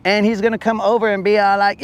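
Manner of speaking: normal talking voice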